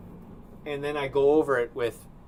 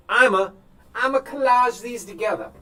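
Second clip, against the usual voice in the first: put-on southern accent; 10 semitones higher, 9 dB louder than usual. Painter hamming a Southern accent to the viewers. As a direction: slip into a broad Southern accent for a laugh. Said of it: "That was a southern accent".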